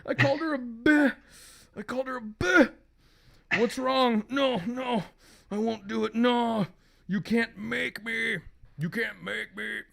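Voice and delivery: high, anguished acted protest; tormented husband. Husband fights what is possessing him, high torment.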